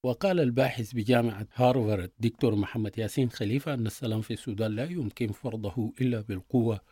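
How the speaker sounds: ordinary talk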